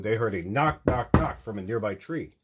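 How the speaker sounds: ordinary delivery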